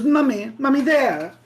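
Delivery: put-on whiny child. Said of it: "he goes".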